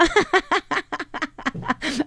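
amused laugh. Real, not acted. Host amused by listener's message, giggling laugh. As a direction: laugh in a long run of giggles.

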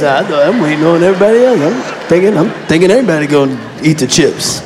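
low, loud bumbling excuse; caught-out boyfriend. Retold or imitated. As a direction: stammer out a dumb excuse. Man's dumb answer caught out.

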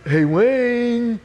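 high, drawn-out call through a door; pestering friend calling, stretched name. Retold or imitated. Friend at the bedroom door at night, high drawn-out calling. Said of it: "then I hear this voice".

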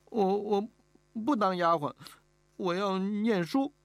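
put-on stammer, then insist in a small girl's high voice; hesitant little girl. Kneeling girl refuses to be a maid, hesitant but insistent.

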